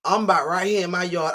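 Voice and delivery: normal talking voice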